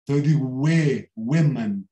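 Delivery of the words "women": women said as WE-man; first vowel as E. Shown as a demonstration. Teacher showing how women is pronounced, first vowel as E. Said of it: "primeiro O do women é um E".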